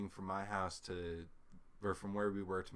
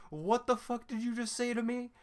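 high-pitched, louder disbelief; incredulous outrage; 12 semitones higher, 6 dB louder than usual. Retold or imitated. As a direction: demand it in high, indignant shock. Retold shock at dad's text.

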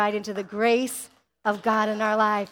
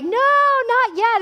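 much higher, louder, slowed squeal; high panicked no; 11 semitones higher, 6 dB louder, much slower than usual. Retold or imitated. Preacher acting someone telling a birthing mother to wait, high panic. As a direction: shriek it like stopping a birth. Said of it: "and you're like".

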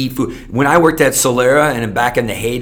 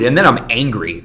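ordinary talk, nothing marked

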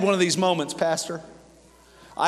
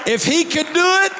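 rising sermon intensity; preaching; 9 semitones higher than usual. Acted. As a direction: drive the point home to the room. Preaching build.

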